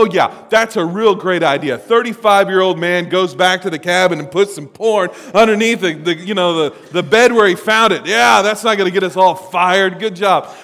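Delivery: loud mock praise, dripping sarcasm; sarcastic leader mocking a bad idea. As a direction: sneer it as fake praise. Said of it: "And I go".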